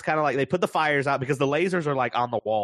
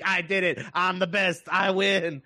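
lightly voiced gleeful boast, a bit higher; gleeful robot; 6 semitones higher than usual. Retelling robot celebrating its win, mild mock glee. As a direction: gloat like a proud toy. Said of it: "He's like".

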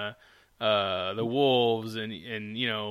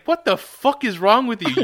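higher, louder imitated outburst; mock outraged retort; 8 semitones higher, 6 dB louder than usual. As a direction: play her appalled comeback, hammed-up. Acts Olivia's outraged reply.

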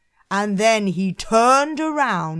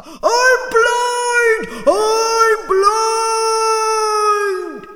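high, loud, drawn-out howls; screaming and howling; 14 semitones higher, 9 dB louder, much slower than usual. Acted. Blinded Cyclops stumbling round the cave, screaming and howling. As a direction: wail it out as a blinded giant. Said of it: "such a loud screaming and howling".

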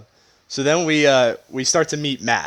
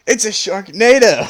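higher, louder, hammed-up reveal; mock excited announcement; 8 semitones higher, 6 dB louder than usual. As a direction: announce it like a movie hook. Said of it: "somebody even says".

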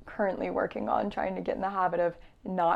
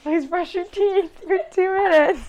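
mock earnest plea; 9 semitones higher, 6 dB louder than usual. Mock announcement of podcast advice.

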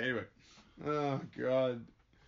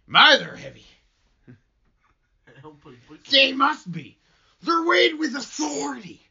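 much higher and louder, grand and pompous; hammed-up Hale; 11 semitones higher, 9 dB louder than usual. Hale boasts his books carry authority, hammed up and high. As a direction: puff it up like a self-important preacher. Hamming Hale's line about books.

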